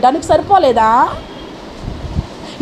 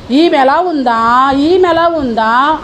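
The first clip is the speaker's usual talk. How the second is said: slow searching voice; 4 semitones lower, much slower than usual. Acted. God inspecting each person.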